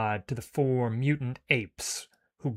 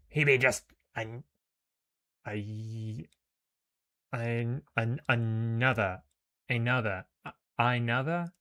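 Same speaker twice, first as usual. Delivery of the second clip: stumbling sneer; slower than usual. Acted. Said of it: "Skinny sneers".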